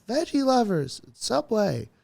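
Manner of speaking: high, slow imitating the heckler; lightly voiced woman repeating herself; mock heckler yell. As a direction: drag it out high like a helpful heckler. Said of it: "She said".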